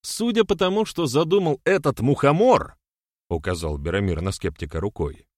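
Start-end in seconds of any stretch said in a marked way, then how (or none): 0.0-2.8 very high, louder, sneering charge; indignant accusation. A narrator's reading. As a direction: point the blame with pitch pushed way up. Furious accusation against the skeptic. (возмущённо, с издёвкой, высоким голосом)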